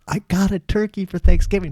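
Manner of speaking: put-on proud little boy showing his catch; proud kid. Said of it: "And I was like, I was like".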